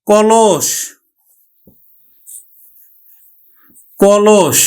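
কলস said carefully; teacher models কলস slowly; pronunciation demo. Shown as a demonstration. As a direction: say কলস as ko-losh, clear. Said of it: "আমরা উচ্চারণ করব".

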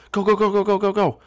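high, rapid string of urging; urgent cheering on. Pretend. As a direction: egg the pig on out the door. Urging pig out the door.

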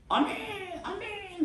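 very high-pitched mock congregational cheer; imitated rowdy amens. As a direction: call out like an overexcited worshipper. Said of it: "다 난리치고 막 이러고 있고".

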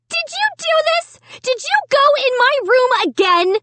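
very high, frantic accusation; screaming. A narrator's reading. Sister interrogating brother about her room, screaming. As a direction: keep screeching the questions up high. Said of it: "Sarah screamed".

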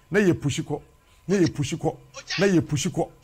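normal talking voice

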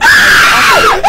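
builds from a cry to a screeching, voiceless scream. Acted. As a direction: lose it completely on the last word. Same line, ends in scream.

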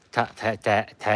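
た with its vowel halfway between あ and え; æ pronunciation. Shown as a demonstration. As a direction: try た leaning toward a "tae" sound. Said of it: "あとえの間の発音で". (「た」を「あ」と「え」の間の母音で)

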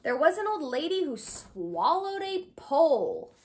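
usual speaking voice

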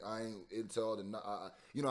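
normal talking voice

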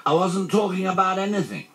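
high, baffled bear voice; puzzled. A narrator's reading. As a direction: protest innocently in a high voice. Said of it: "said Pooh, looking puzzled".

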